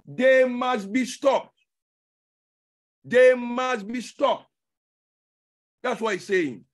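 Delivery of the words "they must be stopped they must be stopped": THEY must be stopped, said loud and slow; slow loud insistence. Shown as a demonstration. Preacher to congregation, loud slow repetition. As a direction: hammer it out loudly.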